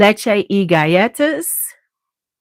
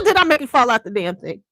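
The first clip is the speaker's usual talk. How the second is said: ordinary talk, nothing marked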